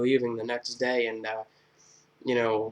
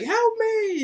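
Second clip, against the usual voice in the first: very high squealed plea; mock cry for help; 3 dB louder than usual. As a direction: shriek it helplessly. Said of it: "she's like".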